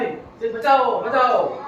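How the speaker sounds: loud, slow desperate shout for help; frightened cry for help. Acted. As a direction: yell for rescue as the lion snarls below. Man cries for help from lion. (સિંહથી ડરીને મદદ માટે બૂમો)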